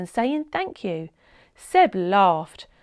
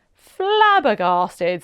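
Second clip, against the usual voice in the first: amazed; 7 dB louder, much slower than usual. A narrator's reading. Seb amazed by turtle.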